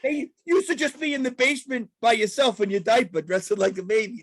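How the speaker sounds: high-pitched comic scolding; Sebastian Maniscalco. Retold or imitated. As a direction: imitate a stand-up ribbing the crowd. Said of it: "He goes".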